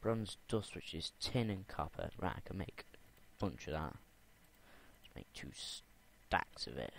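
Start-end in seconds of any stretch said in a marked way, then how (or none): 3.9-6.9 slow whisper. Real, not acted. Crafting bronze dust.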